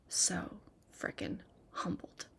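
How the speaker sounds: a soft, breathless whisper of awe; humbled whisper. Real, not acted. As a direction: barely voice it, moved and humbled. Overwhelmed by the compliment.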